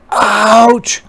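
loud high "Ouch!"; hurt cry. A narrator's reading. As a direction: yelp in pain. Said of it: "cried the spider".

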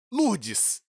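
a high, sharp cry of exasperation; fed-up outburst at the driver; exasperated outburst. Real, not acted. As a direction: vent at the late driver.

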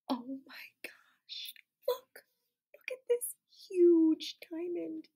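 breathless whisper of wonder; awed whisper; whispered amazement. Acted. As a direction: share a thrilling secret.

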